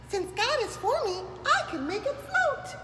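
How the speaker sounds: tiny termite sure she can; little termite voice. Acted. Tina's voice in story.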